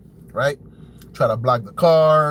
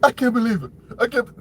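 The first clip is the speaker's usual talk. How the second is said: higher-pitched failed Trump imitation; bad Trump accent; 7 semitones higher, faster than usual. Commentator retrying the gloating president, higher badly faked Trump accent. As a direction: try a Trump voice and miss. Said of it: "I don't know how to do a Trump accent".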